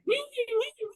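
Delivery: high wailing siren noise; imitated police siren. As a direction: mimic a siren wail with the voice. Imitates police car leaving.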